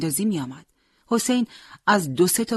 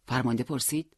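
ordinary delivery, nothing marked

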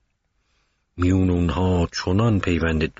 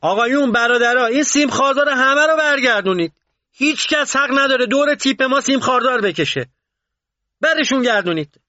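loud, very high-pitched furious command; angry shout; 5 dB louder than usual. A narrator's reading. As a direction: yell the order at the soldiers in a rage. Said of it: "صداش رو بالا برد و سر سربازا فریاد کشید".

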